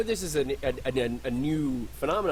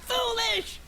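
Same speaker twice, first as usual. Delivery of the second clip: high shouted over-the-top villain; anime villain. Acted. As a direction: ham up a cartoon anime villain. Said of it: "I'm the anime villain".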